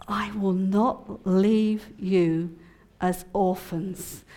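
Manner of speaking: very slow, every word weighed; I will not leave you as orphans, drawn out slow; slow scripture reading. Acted. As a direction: read it like sacred words, slowly. Reading Jesus' words as caption.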